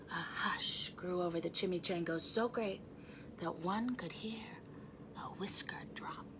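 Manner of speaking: soft unvoiced whisper. A narrator's reading. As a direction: read it in a hush. Hush over the crowd.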